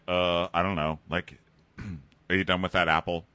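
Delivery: low, hesitant, noncommittal reply; imitating a guy with nothing to say; evasive mumble. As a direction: dodge the question in a low mock mumble.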